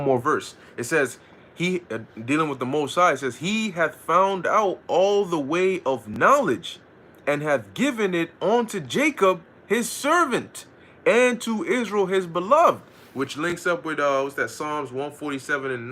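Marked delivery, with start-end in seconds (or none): 3.4-12.8 slow, measured Bible reading; slow King James reading voice; scripture reading. Acted. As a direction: read the verse out solemnly like scripture. Reads scripture verse.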